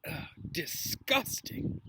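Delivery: drawn-out, slow groan of disgust; a sip of vile potion, slow and breathy; hammed-up disgust. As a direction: pull a face and drag it out. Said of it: "he said".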